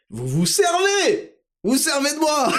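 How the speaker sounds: high, slow mock outrage; hammed-up high indignation. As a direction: cry out in a high hammed-up protest.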